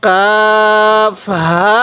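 কাফ হা with the vowels stretched very long; long-held কাফ হা in chanted recitation; drawn-out recitation. Shown as a demonstration. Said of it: "যে হরফের উপর কলম রাখবো".

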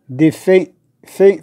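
"دي في" ending on a short closed é. Shown as a demonstration. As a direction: keep the é of "في" inside the mouth. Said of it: "تيدخل داخل الفم".

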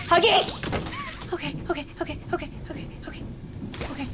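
nervous okays fading to a whisper; steady your nerves, trailing off; scared horror game streamer. Real, not acted. Tense whack game, fading.

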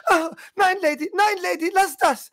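hoch, weinerlich bettelnd. Acted. Geschlagener Junge fleht. (Junge fleht die Lady weinend an)

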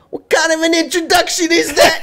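put-on high laughing girly voice; high squeaky game girl; high character voice. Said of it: "She's turning into a robot".

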